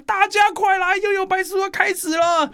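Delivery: very high-pitched mock yell of a sister; imitated excited shout. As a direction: call everyone over in a high, urgent shriek. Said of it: "我姐姐就会大喊". (模仿姐姐高声大喊)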